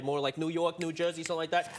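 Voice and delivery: ordinary delivery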